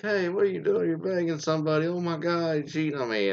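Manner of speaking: high, accusing mock wife voice; a hammed-up wife, pitched well up. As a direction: play the shocked wife catching him out. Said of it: "she's like".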